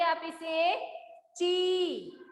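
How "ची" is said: "ची" with the ee held long; long ee vowel. Shown as a demonstration. Teacher joining च and ई for students, long-drawn "ची". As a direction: stretch out the vowel of "ची". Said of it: "लंबा हो जाता है".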